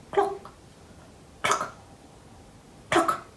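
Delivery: soft whispered hen clucks. Acted. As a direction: cluck under your breath. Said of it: "they whispered".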